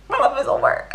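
high, hammed-up wail; overdramatic mock despair. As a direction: exaggerate the character's despair for laughs. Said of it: "she didn't say it like that".

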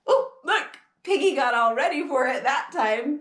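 ordinary delivery, nothing marked

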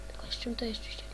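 thinking aloud in a whisper; whispered musing. Real, not acted. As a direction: whisper the question to yourself. Musing to himself. (szeptem do siebie)

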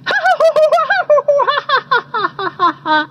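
high, rapid kookaburra cackle; kookaburra laugh. A narrator's reading. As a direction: imitate a laughing kookaburra's rolling call. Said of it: "first heard the kookaburras laughing".

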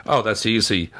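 normal talking voice

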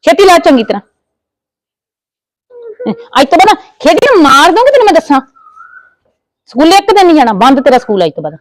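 loud, high, fast furious threat; angry threats. Acted. Aunt threatening to beat the niece and stop her school, shouting.

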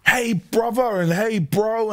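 over-familiar bro greeting. Retold or imitated. Acquaintance greeting him as bro, mocked high matey voice. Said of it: "he's coming in like".

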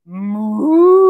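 a loud single moo; cow moo. Pretend. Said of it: "they always say".

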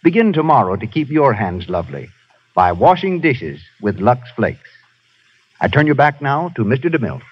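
ordinary talk, nothing marked

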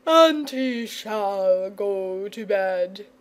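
slow, drawn-out dragon voice; dragon's cry. A narrator's reading. Dragon starting up at George's scolding, emphatic cry. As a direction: declare it slowly, stretching each word. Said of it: "cried the dragon".

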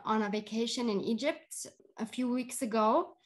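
usual speaking voice

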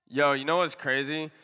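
ordinary talk

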